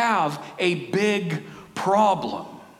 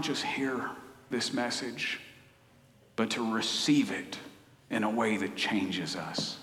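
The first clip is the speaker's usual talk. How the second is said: hushed prayer sinking lower to the amen; spoken prayer; 3 semitones lower, 3 dB quieter than usual. Acted. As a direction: pray it quietly from the heart. Leads the congregation in prayer.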